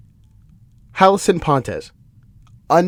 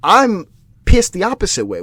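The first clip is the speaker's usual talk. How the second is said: ordinary delivery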